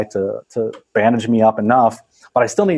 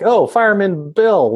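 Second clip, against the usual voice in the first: cheery greeting; 9 semitones higher, slower than usual. Retold or imitated. Lifeguard greets fireman happily.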